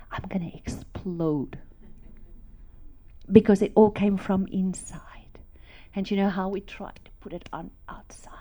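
soft, unvoiced telling of overwhelming love; awed whisper. Real, not acted. To the congregation, describing God's love filling her, whispered awe. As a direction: hush it, full of awe.

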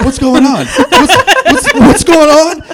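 high, loud, slowed, laughing what's going on; laughing disbelief. Retold or imitated. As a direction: crack up in shock. Shocked to hear he's on TV.

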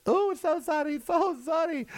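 high-pitched apology in a mock Chinese accent. Comic imitating China apologizing for its balloon, mock Chinese accent. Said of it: "Am I making fun of the Chinese accent?".

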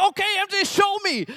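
put-on high, hyped-up challenge to a toddler; excited dad. Dad dares his toddler to show him, high and excited.

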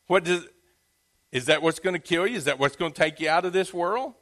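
ordinary talk

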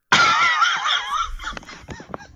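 high burst of laughter; loud shrieking laugh. Real, not acted. Big laugh at Zuckerberg joke.

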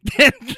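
quick high burst of laughter; short laugh. Real, not acted. Recalling onlookers laughing at her, short high laugh. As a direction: break off to laugh.

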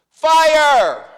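sudden high loud shout of alarm. Acted. Warning a crowded theater of fire, full shout. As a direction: yell it out as a warning. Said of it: "someone shouted in a crowded theater".

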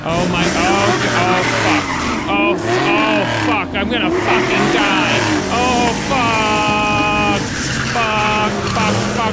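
loud, frantic swearing in terror; panicked yelling. Acted. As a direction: scream like you might die. Car circled, panicked yelling.

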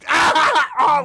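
high, imitated maniac laugh; crazed laughter. Comedian showing how he walked around all day, crazed laughter. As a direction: burst out cackling like a crazy person. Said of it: "like, laughing like a fucking crazy person".

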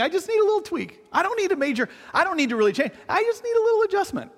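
high, whiny I just need a tweak; sing-song self-satisfied voice; mocking complacent voice. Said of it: "if you're saying".

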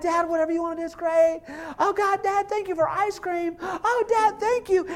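put-on high, gushing little-kid voice; imitating adoring kids. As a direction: gush gratefully like a small child.

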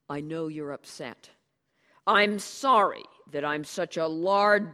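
loud, bitter mock apology; sarcastic diatribe. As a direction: spit the self-insults out loud and bitter. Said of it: "the diatribe backfired".

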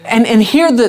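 ordinary delivery

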